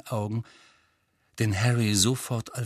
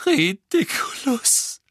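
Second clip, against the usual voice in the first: high, slow sobbed spell, voice breaking; a desperate mother sobbing out the spell; 12 semitones higher, 4 dB louder, slower than usual. Acted. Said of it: "schluchzte Misses Weasley". (hoch und langsam schluchzend, verzweifelt)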